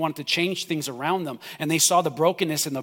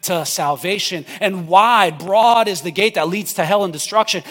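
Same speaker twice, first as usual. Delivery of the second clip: forceful preaching; 6 dB louder than usual. Acted.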